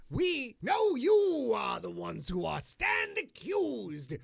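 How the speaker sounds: slow booming accusation; mock courtroom shout. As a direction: declare it like a judge.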